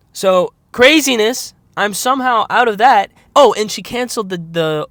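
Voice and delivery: loud, high burst of amazement; amazed excitement. Real, not acted. Creator telling viewers the crazy good news, excited. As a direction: exclaim it loudly, pitched well up. Amazed at money problem being solved.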